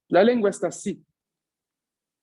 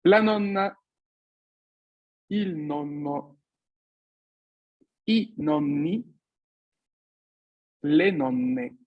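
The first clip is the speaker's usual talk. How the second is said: careful repetition; much slower than usual. Shown as a demonstration. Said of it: "repito, por si ya quieren ir avanzando con las terminaciones".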